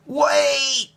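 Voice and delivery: high, urgent call; boy calling to see a volcano. A narrator's reading. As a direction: call out to stop. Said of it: "he called".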